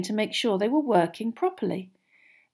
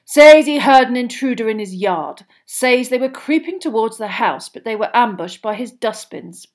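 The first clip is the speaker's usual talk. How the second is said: Mr Diggory; 2 semitones higher, 9 dB louder than usual. A narrator's reading. Diggory voiced.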